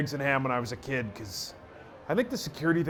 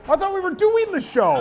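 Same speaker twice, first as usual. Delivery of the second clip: high, loud bewildered objection; loud, pitched-up pushback; indignant protest; 14 semitones higher, 5 dB louder than usual. Real, not acted. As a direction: protest in a raised, exasperated pitch. Bickering over whether show started.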